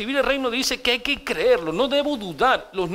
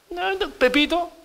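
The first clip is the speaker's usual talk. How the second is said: put-on child refusing; 10 semitones higher than usual. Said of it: "así vamos a tener que enseñar".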